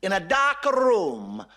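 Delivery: high, slow exasperated Pope; lightly voiced Pope losing his temper; heated Pope. Pope shouting at the atheist.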